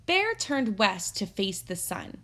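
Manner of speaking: ordinary talk, nothing marked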